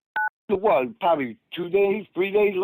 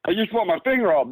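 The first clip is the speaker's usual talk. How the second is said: usual speaking voice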